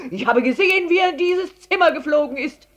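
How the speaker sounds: laut, hoch und gehetzt; außer Atem vom Rennen. A narrator's reading. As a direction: atemlos Anzeige erstatten. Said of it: "Man merkte, dass er sehr gerannt war". (abgehetzt und aufgeregt)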